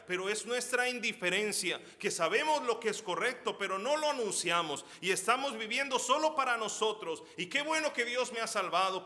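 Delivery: loud, high, impassioned sermon voice; fiery preaching. Acted. Pastor urges believers to share the gospel, fiery. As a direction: plead with the congregation, full force.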